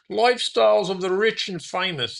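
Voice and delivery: Robin Leach impression. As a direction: sell Lifestyles of the Rich and Famous like Robin Leach's TV host accent, way up high. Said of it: "That's probably a terrible accent".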